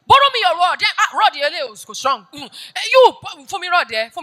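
loud, high mock whine of a beggar; mocking the borrower. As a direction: ham up a needy borrower, loud and pleading. Mocking people who borrow rods.

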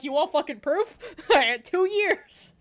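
loud, high, hammed-up comeback; play-outraged yell with a laugh; mock defiant shout. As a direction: throw it back at an imagined accuser, laughing. Imagined retort to being sued.

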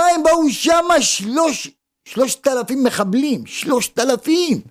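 high, impassioned stress on the huge number; astonished emphasis. Real, not acted. Preacher amazed at number of attackers. (הדגשה נדהמת בקול גבוה)

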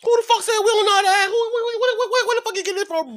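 high, fast indignant outburst; outraged. Real, not acted. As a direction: fire back in disbelief. Outraged reply to insult.

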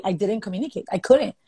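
ordinary delivery

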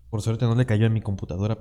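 ordinary talk

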